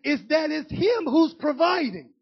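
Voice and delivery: loud preacher's drive, pushing each phrase; preaching at full force. Acted. Preacher urging the church to give first, preaching hard.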